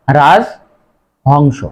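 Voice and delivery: রাজহংস as raj-hongsho, drawn out very slowly; correct pronunciation. Shown as a demonstration. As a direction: model the right রাজহংস. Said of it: "সঠিক উচ্চারণ হবে".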